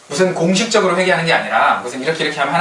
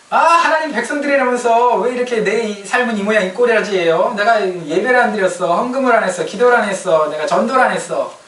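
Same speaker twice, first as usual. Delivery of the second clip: mock railing at God; 8 semitones higher than usual. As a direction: pile up complaints in a hammed-up protest. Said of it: "막 하나님께 삿대질". (삿대질하듯 과장된 항의)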